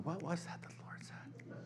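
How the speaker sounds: breathy puzzled whisper to yourself. Retold or imitated. Listener later that afternoon, whispering, puzzled. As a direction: whisper it like you've forgotten.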